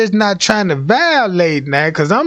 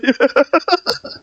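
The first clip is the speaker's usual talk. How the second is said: high giggle; short breathy laugh; 7 semitones higher than usual. Real, not acted. Laughing at himself.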